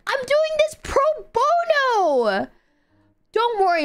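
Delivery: hammed-up indignant wail; exasperated protest. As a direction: cut in with a high, stretched protest. Cutting off granny's refusal in game.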